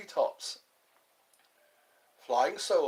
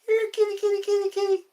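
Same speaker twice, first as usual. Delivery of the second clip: very high, louder kitty call; 5 dB louder, much faster than usual. Acted. Calling out to a kitten in a very high voice.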